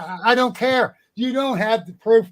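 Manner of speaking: hammering the point home, riled up; getting angry. Real, not acted. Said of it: "I get angry, very angry".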